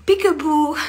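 "Peekaboo" high and loud; peekaboo to a child. Shown as a demonstration. Adult scaring a little child in play, high and loud. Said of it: "scare a little child".

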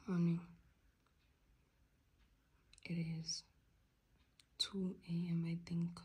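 soft, drowsy whispering; sleepy whisper. Real, not acted. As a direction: whisper it, half asleep. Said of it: "I'm so tired".

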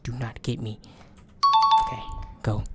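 breathy urgent whisper. Real, not acted. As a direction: whisper it so he can't hear. Sneaking past the monster.

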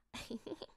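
short, quiet chuckle; soft giggle. A narrator's reading. Bill amused at the giant's answer, giggling. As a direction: giggle softly to yourself. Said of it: "Bill kicherte".

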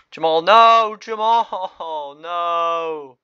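anguished mock shout. Real, not acted. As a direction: howl his name as he charges. Favourite soldier charges in.

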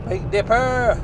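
loud, high thrilled holler; excited shout. Real, not acted. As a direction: call it out like on a fairground ride. Car dips on country road.

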